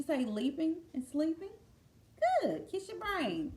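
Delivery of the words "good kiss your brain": plain reading voice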